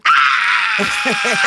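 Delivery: loud startled yell of Ah; mock scream at the airport; retold scream. Telling her friend how she screamed at the stranger, loud yell. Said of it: "Girl, I screamed".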